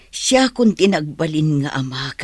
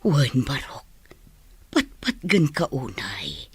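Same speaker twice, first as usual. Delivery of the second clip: tender whisper; 2 semitones lower than usual. Acted. Grandmother to grandson, tender whisper. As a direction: breathe it gently to him.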